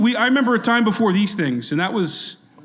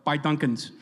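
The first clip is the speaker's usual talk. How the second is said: lower, bad Boston accent; mock a movie star's horrible Boston accent; hammed-up Boston accent, dropped R's; Ben Affleck; 5 semitones lower, 5 dB quieter than usual. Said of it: "that horrible accent that he does".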